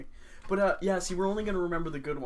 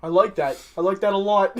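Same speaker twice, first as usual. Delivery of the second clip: imitating; 4 semitones higher, 6 dB louder than usual. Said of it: "And they're just like".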